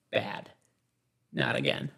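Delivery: low, soft scolding of a pet; low hushed "bad dog" scold; dog owner scolding. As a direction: tell off a naughty dog quietly.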